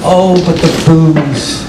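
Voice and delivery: usual speaking voice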